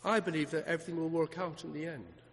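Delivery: soft, low, halting mock answer; imagined hesitant reply. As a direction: fumble softly for someone else's answer. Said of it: "And they say".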